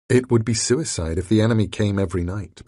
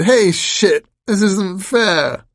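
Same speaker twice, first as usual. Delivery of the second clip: much higher, louder outraged wail; panicked whine; 12 semitones higher, 8 dB louder, slower than usual. A narrator's reading. Grand Al losing his arm, shrieking in panic. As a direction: wail that it's unfair.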